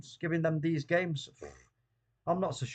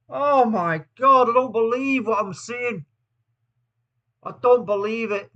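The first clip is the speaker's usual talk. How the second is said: stunned disbelief; 4 semitones higher, 8 dB louder than usual. Real, not acted. Reacting to a fourth goal.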